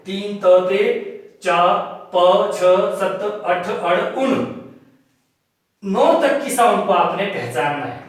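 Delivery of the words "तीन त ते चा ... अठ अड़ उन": slow, one syllable at a time; sounds spelled out. Shown as a demonstration. Teacher listing the number sounds slowly for students. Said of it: "pronounce करेंगे हम".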